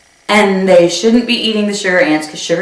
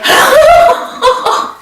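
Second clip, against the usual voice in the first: shocked laughing yelp; 3 dB louder than usual. Retold or imitated. Shocked reaction to the bite.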